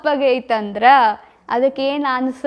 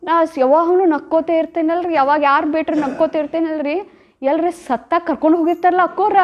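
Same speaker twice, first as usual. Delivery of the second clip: ordinary talk, nothing marked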